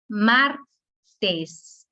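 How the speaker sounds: Martes split as MAR, pause, tes; syllable by syllable. Shown as a demonstration. Teacher showing the stressed syllable, Martes broken in two. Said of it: "La sílaba tónica es mar".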